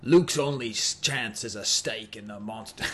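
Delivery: put-on low, measured British announcer accent; British accent that slips into Australian; attempted British accent. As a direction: try a British toy-ad narrator, drifting Australian. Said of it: "How do I kick myself in a British accent".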